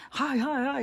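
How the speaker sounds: high, eager dog voice saying hi; happy dog greeting. Retold or imitated. Dogs passing on the street, voiced high and eager.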